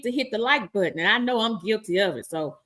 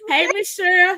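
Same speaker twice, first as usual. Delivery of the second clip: laughing greeting; 8 semitones higher, 5 dB louder than usual. Real, not acted.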